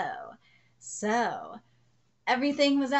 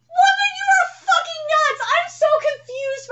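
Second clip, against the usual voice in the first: high, loud disbelieving yell; exasperated shout; 11 dB louder than usual. Real, not acted. Fan yelling at Saki in loud disbelief.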